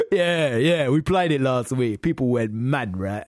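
loud, boastful club DJ; booming DJ voice. Retold or imitated. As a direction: boom it out like you're on stage. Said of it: "in such a booming voice".

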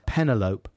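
"Penelope" to rhyme with envelope; mispronounced name. Shown as a demonstration. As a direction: misread "Penelope" as PEN-uh-lope. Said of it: "always pronounced as Penelope".

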